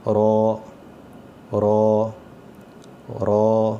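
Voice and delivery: رو with a thick, full sound from the throat; not a thin را, make رو heavy. Shown as a demonstration. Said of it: "ایسے پڑھنا ہے کہ". (رو موٹی آواز میں)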